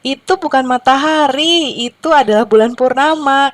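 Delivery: high-pitched storybook correction; lightly voiced owl. A narrator's reading. Owl tells the chickens it's the moon, lightly acted correcting. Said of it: "tertawa terbahak-bahak".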